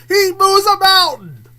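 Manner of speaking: high, loud disbelieving shout; incredulous outburst. Real, not acted. Telling viewers about the ridiculous trailer, incredulous shout. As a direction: blurt it out in disbelief.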